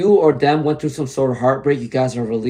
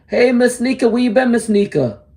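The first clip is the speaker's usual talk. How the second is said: excited greeting; 10 semitones higher than usual. Real, not acted. Tarot reader greeting a returning viewer, excited. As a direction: sing out her name, thrilled she's back.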